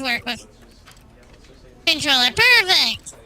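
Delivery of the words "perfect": delighted burst, louder; excited. Real, not acted. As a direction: cheer it out. Controller finally works.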